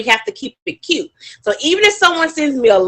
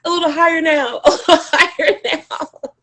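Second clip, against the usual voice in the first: higher-pitched, amused coaxing; laughing prompt; 7 semitones higher than usual. Real, not acted. Presenter coaxing the audience to guess higher, laughing. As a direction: nudge the guessers upward through a laugh.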